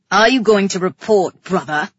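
loud, impatient snap; snapping. A narrator's reading. King demanding a report from his brother, snapping. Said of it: "he snapped".

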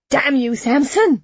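heated, rising outcry; angry shout. A narrator's reading. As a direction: shout in exasperation. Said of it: "the mayor shouted".

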